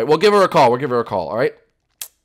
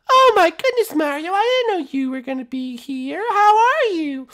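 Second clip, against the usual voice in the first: high falsetto, excited greeting; delighted princess voice; 3 dB louder than usual. Acted. Princess surprised to see her friend, high delight. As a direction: squeal a delighted hello, stretching the end.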